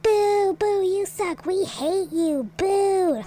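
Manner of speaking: high, drawn-out heckling boos; mock crowd booing. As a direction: jeer like a hostile audience. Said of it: "as everyone boos him".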